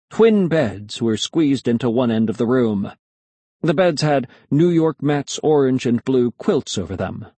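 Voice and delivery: plain reading voice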